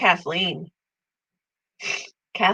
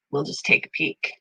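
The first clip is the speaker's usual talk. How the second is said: whispering; 2 semitones lower, 7 dB quieter than usual. Real, not acted. Aside while checking something.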